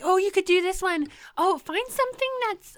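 high, loud mimicry of mom; mom's voice. Daughter quoting mom's beer advice, high imitation. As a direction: play mom, eager and high-pitched.

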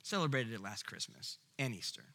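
soft, jaded and dismissive; bored shrug. Acted. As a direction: shrug it off like old news. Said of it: "we're like".

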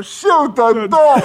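very high, loud, drawn-out scream; background yelling. Retold or imitated. Prankster playing the victim in the background, hysterical scream. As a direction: wail it hysterically at full volume. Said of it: "in the background yelling".

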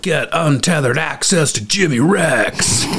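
booming hype announcer voice; monster truck ad. Retold or imitated. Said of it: "Sounds like a Monster Truck ad".

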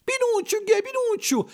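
very high, excited falsetto puppet cries; high-pitched joyful puppet clamour; puppets all crying out. A narrator's reading. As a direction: squeal the name like a troupe of puppets. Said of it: "gridara tutti i pupi".

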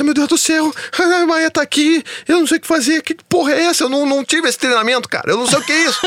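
high-pitched, hammed-up freak-out; acted-out panic. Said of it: "comecei a bater um ataque cardíaco". (pânico encenado, com a voz bem aguda)

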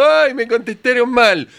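mock crybaby whine. Mocking thin-skinned artists with a whiny crybaby complaint.

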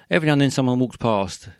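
ordinary talk

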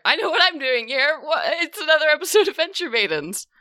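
high, loud excited hello; excited greeting. Real, not acted. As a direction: burst in with a big hello. Excited high show opening greeting.

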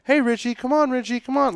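put-on high sing-out to a little boy; calling a kid. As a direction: imitate someone coaxing a child along. Imitates adults calling him as kid.

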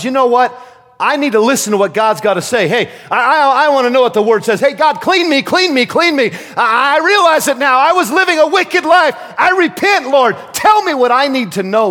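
loud, high-pitched pleading cry to God; urgent repentance. Acted.

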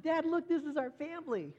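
put-on high, excited voice of a five-year-old; eager little girl presenting her picture to dad; little daughter's voice. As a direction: show off the drawing like a proud small child. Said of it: "And she said".

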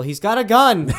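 hammed-up panicked shout, ready to laugh; mock yell. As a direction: yell a pretend warning. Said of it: "if you yell".

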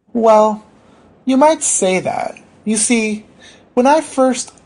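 high, halting patient voice; meek patient feeling for words; hesitant patient. Acted. Patient hedging before being cut off.

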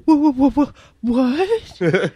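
high, loud stammering disbelief; mock baffled stutter; flabbergasted. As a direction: imitate someone sputtering in shock. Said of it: "doesn't even know how to respond".

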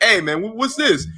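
high, wide-eyed mock Goldberg; imitating Goldberg asking for the belt. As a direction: play him as a kid spotting a toy. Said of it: "and you're like".

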